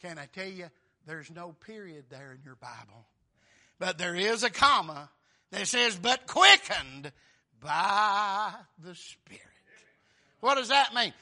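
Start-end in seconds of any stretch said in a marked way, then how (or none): 3.8-11.1 loud, punched-out proclamation; emphatic preaching. Real, not acted. Preacher pointing congregation to a comma in scripture, emphatic preaching. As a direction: stretch the key scripture words loudly.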